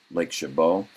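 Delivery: ordinary talk, nothing marked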